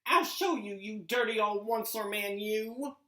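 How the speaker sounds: the Lorax scolds the factory owner loudly; angry Lorax. Acted. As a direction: lecture him with rising anger. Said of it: "snapped the Lorax, his dander was up".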